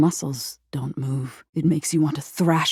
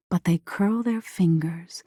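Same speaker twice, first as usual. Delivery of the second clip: plain reading voice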